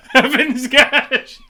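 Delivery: high, loud, slow laughing repeat; laughing disbelief. Real, not acted. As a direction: burst out laughing at the words.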